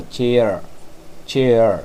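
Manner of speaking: चेयर ending on a half-said r; half r demo. Shown as a demonstration. Teacher showing students चेयर: r half said. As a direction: say चेयर with the r only half pronounced. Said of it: "आर तो आधा बोल करके रखना ही है".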